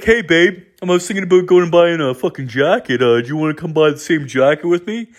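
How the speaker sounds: high, goofy mock boyfriend asking sweetly; high dopey partner voice, lightly mocking. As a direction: send up the guy proposing matching jackets. Mocking the matching couple, boyfriend.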